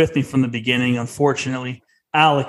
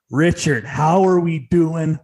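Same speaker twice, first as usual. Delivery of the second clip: ordinary talk, nothing marked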